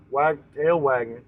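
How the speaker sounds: ordinary delivery, nothing marked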